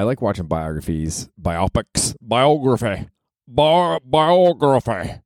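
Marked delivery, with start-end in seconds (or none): 2.2-5.2 high, drawn-out silly "biography"; goofy, sing-out "biography"; playful word mangling. Pretend.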